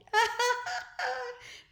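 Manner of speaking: very high cackling laughter. A narrator's reading. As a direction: laugh at the headmaster. Children laughing at headmaster.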